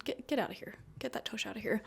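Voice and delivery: quiet half-laughing shoo at the cat; soft shooing. Real, not acted. Shooing the cat off her tarot pile, softly. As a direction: softly chase the cat away.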